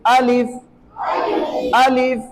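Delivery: अलिफ said crisp and short, vowel not stretched; short alif. Shown as a demonstration. Teacher modelling a short, correct अलिफ. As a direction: clip अलिफ short and firm. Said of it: "अलिफ को लंबा बिल्कुल नहीं करेंगे".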